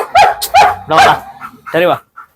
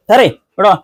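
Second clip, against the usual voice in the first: ordinary talk, nothing marked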